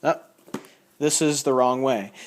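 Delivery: usual speaking voice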